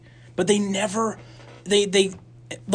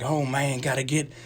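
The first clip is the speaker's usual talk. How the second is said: hard cliche Southern drawl; mock a movie Southerner's thick accent. Friend mocking the war movie's characters, cliche Southern accent. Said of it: "like, Southern accents or".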